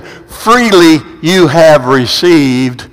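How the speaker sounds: very slow, low and weighty; solemn scripture quote. Retold or imitated. Pastor quoting Jesus to the congregation, slow and solemn. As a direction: draw each word out like scripture. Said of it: "he said".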